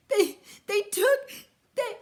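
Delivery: high, loud, slow stammer near tears; upset cub stammering through tears; choked up. Acted. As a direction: sputter it out, too upset to speak. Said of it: "so angry she could hardly speak".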